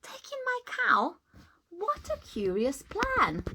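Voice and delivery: put-on high, incredulous old lady; baffled by the odd advice; puzzled surprise. Old lady doubting the wise man's plan, incredulous.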